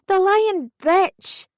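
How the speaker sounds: high, slow furious screech; screeched insult. Acted. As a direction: shriek it out in fury at the lying tenant. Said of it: "I screeched".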